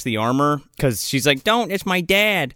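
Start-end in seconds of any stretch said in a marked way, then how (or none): 1.4-2.5 slow, raised-pitch movie plea; mock pleading. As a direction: lightly imitate a girl begging for her father. Retold girl pleading for dad.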